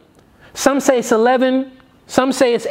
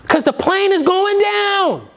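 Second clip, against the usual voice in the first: urgent warning; 9 semitones higher than usual. Acted. Passenger warning those who laugh.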